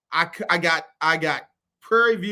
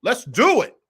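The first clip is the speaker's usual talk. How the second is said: higher and louder, rising on do; hyped opener; 6 semitones higher, 6 dB louder than usual. Real, not acted. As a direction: kick the show off with a punch. Hyped opening call to start.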